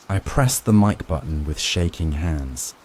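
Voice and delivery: ordinary delivery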